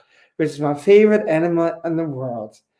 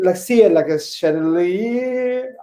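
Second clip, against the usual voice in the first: ordinary delivery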